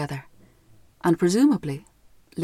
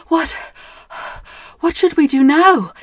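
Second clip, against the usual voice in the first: panting; 8 semitones higher, 6 dB louder than usual. Acted.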